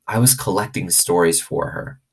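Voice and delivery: ordinary delivery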